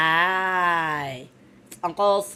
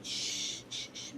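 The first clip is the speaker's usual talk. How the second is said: soft unvoiced shushing; 14 dB quieter than usual. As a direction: hush the baby to sleep. Mother hushing the baby.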